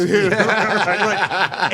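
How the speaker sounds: high, loud, real laughing agreement. Real, not acted. Host reacting to the lion joke, laughing. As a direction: agree through a burst of laughter.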